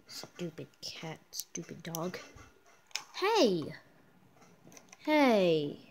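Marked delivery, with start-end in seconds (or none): 0.1-2.2 muttering. Pretend. Muttered insult at both.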